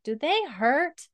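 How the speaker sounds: put-on high, slow small child asking; little boy voice. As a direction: play a curious toddler, drawn out.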